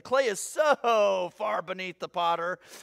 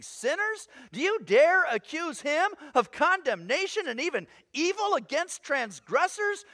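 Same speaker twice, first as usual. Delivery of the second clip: indignant challenge; 2 semitones higher than usual. Acted. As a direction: build each accusing question on the last. Challenges those who accuse God.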